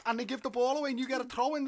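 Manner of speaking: normal talking voice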